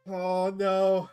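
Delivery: high, long, held wail; loud dismay. Real, not acted. Player reacting to the game going wrong, loud dismay.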